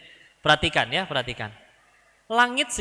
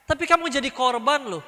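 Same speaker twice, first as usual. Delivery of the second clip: egging on; 8 semitones higher than usual. Acted. Voices someone egging on the victim.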